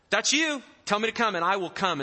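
high, loud, gutsy offer; bold eagerness. Acted. Peter willing to leave the boat, bold eagerness. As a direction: pipe up eagerly and loudly.

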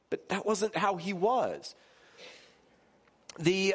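usual speaking voice

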